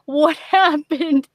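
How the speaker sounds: slow, drawn-out stunned question; mock bewildered disbelief. Gamer retelling her shock at the level, slow and stunned. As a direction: gape at the ruined game, slowly. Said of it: "I was like".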